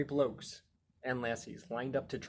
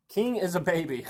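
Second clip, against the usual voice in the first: higher, louder taunting sing-song; mocking; 5 semitones higher, 7 dB louder than usual. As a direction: tease like a naughty kid. Reading dragon's rude scribble.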